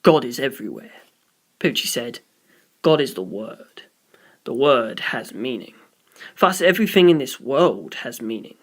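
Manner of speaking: a low, calm preacher-like voice; low priest voice. Acted. Priest calmly telling the visitor all has meaning.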